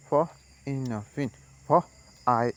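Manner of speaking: normal talking voice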